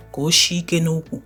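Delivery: ordinary delivery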